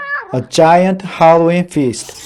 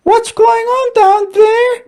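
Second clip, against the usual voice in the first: very high squeaky squirrel call; sleepy squirrel shouting. A narrator's reading. Sleepy squirrel woken up, calling down crossly. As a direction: call down grumpily from the treetop. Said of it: "shouted a sleepy-eyed squirrel".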